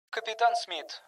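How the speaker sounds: softly voiced woman coming through the intercom; woman over intercom. A narrator's reading. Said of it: "произнёс динамик женским голосом". (тихий женский голос из динамика)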